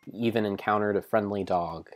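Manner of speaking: plain reading voice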